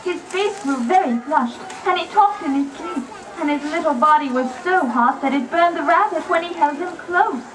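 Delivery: ordinary talk, nothing marked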